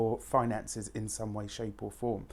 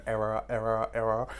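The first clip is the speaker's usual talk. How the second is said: glitching robot. Pretend. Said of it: "my machinery's not working too well today".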